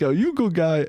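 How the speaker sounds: put-on lightly accented old man; a hint of a broken-English old man; mild mock accent of the stepdad; imitating old man's accent. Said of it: "that old man's like".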